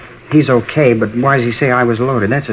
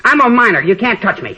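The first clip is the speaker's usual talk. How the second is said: defiant taunt; 9 semitones higher than usual. Acted. Teen taunts police.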